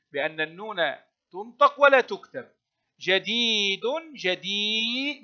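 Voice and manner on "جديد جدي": teacher stretching the vowel in جدي; long ya. Shown as a demonstration. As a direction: draw out the ii in جدي. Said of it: "مد الياء".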